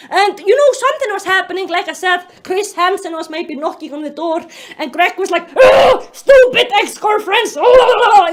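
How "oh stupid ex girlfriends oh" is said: loud, raised mock rage; angry ranting. Mocking Greg angry at his exes, loud ranting. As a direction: rant like a furious, sputtering writer. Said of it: "you know, he was like this".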